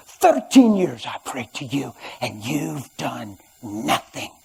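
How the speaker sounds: slow, unvoiced whisper to God; retold whispered prayer. Wife whispering her grievance up at the ceiling, slow and bitter. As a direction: whisper a bitter complaint heavenward. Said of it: "looked up at the ceiling and told".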